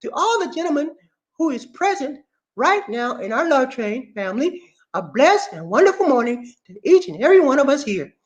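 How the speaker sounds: high put-on message voice; high-pitched proclamation of a morning blessing; reading a greeting. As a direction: read it out high, with swooping formality. Reads Lorna's greeting message aloud.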